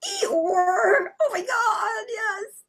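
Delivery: high, squealing agreement; delighted recognition. Real, not acted. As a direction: squeal it, thrilled by the perfect answer. Excited at someone suggesting Eeyore.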